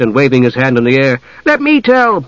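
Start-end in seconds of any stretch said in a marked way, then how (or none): 1.4-2.2 high-pitched eager plea; excited cry. A narrator's reading. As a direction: beg eagerly to be picked. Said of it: "cried Tas, sitting bolt upright".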